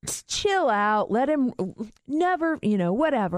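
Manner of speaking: high, amused brother voice; laid-back brother. Retold or imitated. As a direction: tease it, half laughing. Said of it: "He goes like".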